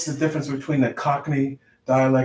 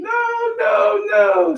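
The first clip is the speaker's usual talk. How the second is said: high, loud mock queen protesting no; 6 dB louder, much slower than usual. Teasing the old queen refusing, high hammed-up shout. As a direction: shriek it like a flustered old queen. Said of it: "The queen's too old to be going".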